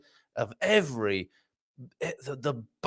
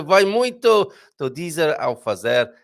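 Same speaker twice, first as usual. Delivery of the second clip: Portuguese; 4 semitones higher, 6 dB louder than usual. Shown as a demonstration. Repeats the Portuguese proverb.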